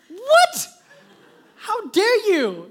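high, loud, indignant outburst; mock outraged shout. Dad watching daughter flaunt trophy, hammed-up outrage. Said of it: "And I'm getting angry".

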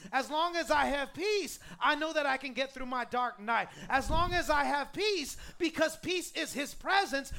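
loud, driving declaration; emphatic, rhythmic pulpit build; fervent preaching. Real, not acted. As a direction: proclaim it with rising conviction, hitting each refrain.

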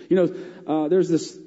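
ordinary delivery

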